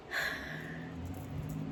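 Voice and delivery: long unvoiced exhale; heavy sigh. Real, not acted. Storyteller pausing as the memory gets emotional, heavy sigh. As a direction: let out a real sigh. Pauses, upset while retelling.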